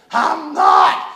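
imitating a choked, heaving child; between heaves. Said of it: "in between heaves".

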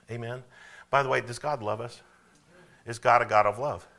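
normal talking voice

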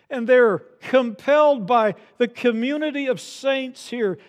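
high, loud, sustained pulpit crescendo; impassioned preaching. Real, not acted. Pastor urging that church be about Jesus, impassioned preaching. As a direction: preach it at full force, driving each phrase.

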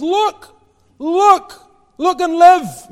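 high, loud proclamation; crying out. Acted. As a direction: call out to the crowd. Preacher voicing John crying out.

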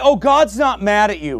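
mocking quote. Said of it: "mocking the soft message".

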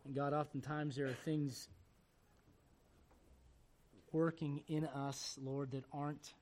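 earnest prayer with music beneath; pastoral prayer. Acted. The pastor prays over his bowed congregation, earnest and humble.

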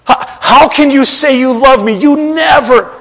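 put-on child accusing a parent, growing to an indignant yell; complaining child. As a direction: play the hurt kid yelling at a parent. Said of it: "and you claim".

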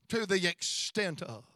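ordinary talk

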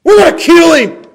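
loud, high outburst of rage; out-of-control anger. Acted. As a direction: explode as an enraged mob. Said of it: "Their anger is just".

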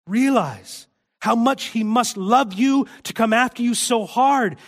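high and loud, pressing each point home; fervent preaching. Acted. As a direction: preach it at full force to the congregation. Preacher urging the congregation.

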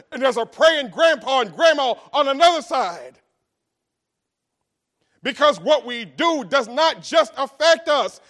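loud, rising preacher's cry; preaching at full force. Real, not acted. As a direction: preach it out to the congregation.

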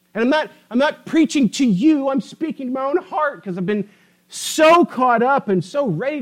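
fervent preacher pressing the congregation; full-force preaching. Acted. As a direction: drive it home from the pulpit.

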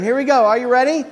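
ordinary delivery

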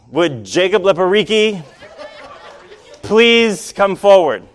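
mock airport announcer voice; loudspeaker announcement. Gate agent over loudspeaker.

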